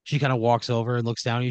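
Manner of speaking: usual speaking voice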